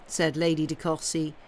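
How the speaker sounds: plain reading voice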